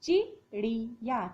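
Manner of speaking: चिड़िया sounded out slowly after spelling it; blended spelling. Shown as a demonstration. Teacher blends the spelled syllables for pupils. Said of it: "य में आ की मात्रा या".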